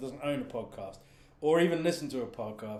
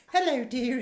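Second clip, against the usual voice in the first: a hint of an old lady, higher and louder; Missus Hayward voice; 11 semitones higher, 7 dB louder than usual. Man trying out the Missus Hayward old lady voice. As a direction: try out the old lady's greeting. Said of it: "I can't do the voice".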